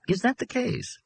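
high-pitched, curious question; interested. A narrator's reading. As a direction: ask with keen, clinical interest. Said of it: "Glaub, interested, said to Bolan".

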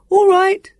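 high, loud shout; loud high-pitched answering call; calling back. A narrator's reading. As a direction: holler your agreement across. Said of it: "Sam called back".

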